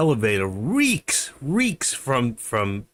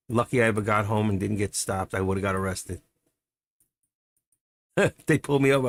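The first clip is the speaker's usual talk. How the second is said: ordinary delivery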